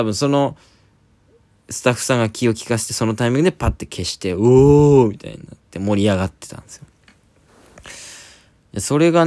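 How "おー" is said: mock crowd cheer. Retold crowd cheering at blackout.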